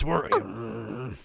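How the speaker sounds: ordinary delivery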